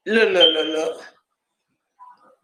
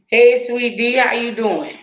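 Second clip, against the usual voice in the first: sweet greeting; 6 semitones higher than usual. Real, not acted. Greeting sweet D with a raised sweet voice.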